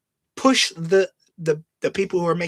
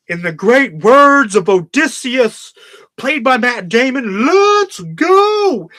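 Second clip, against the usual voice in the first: slow, loud, grand then a high shout; a future fan quoting the film with mock reverence; mock solemn quote; 6 semitones higher, 8 dB louder, slower than usual. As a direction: ham up a reverent tribute ending in a yell. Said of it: "somebody goes".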